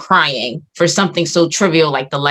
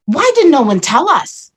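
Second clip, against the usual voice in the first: higher-pitched exasperated question; retold outraged disbelief; 7 semitones higher, 4 dB louder than usual. Woman to her friend about IVF memory loss, retold outraged disbelief. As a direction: demand why you weren't warned.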